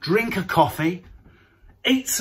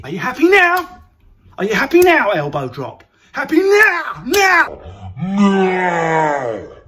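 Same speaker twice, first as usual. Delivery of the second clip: mock rage; 5 dB louder, slower than usual. Ranting at a smug lockdown achiever, hammed-up shouting. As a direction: scream at them till your voice cracks. Yelling at imagined show-off.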